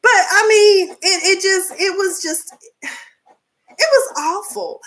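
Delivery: high laughing disbelief. Real, not acted. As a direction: get the words out through laughter. Laughing while recounting.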